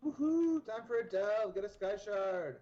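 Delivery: high, loud celebratory whoop; excited cheer. Real, not acted. Player cheering to a friend as a delve starts, excited. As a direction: cheer it out, stretching the last words.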